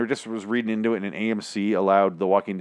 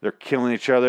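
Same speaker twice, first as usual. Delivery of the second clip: ordinary talk, nothing marked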